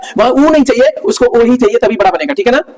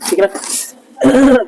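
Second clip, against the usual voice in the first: laughing; much slower than usual. Real, not acted. Laughs while checking with niece.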